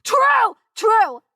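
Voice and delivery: loud, high call of agreement; emphatic agreement. Real, not acted. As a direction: shout it out in firm agreement. Shouting agreement over the video.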